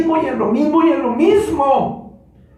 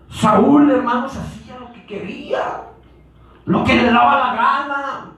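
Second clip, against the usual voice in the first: normal talking voice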